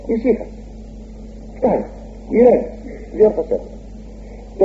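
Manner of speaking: high, soft urging; God calming Cain. Acted. God again calming Cain, high and soft. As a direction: settle him down kindly. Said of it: "ο Θεός πάλι του λέγει".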